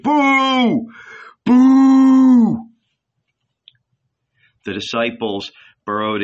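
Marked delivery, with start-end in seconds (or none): 0.0-2.7 long, loud, high boos; crowd jeering. A narrator's reading. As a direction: boo hard, holding the vowel out.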